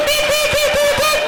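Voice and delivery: very high squeaky beeping noises; call button beeps. Retold or imitated. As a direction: mimic an electronic beep.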